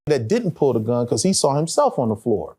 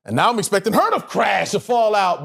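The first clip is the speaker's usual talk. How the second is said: ordinary talk, nothing marked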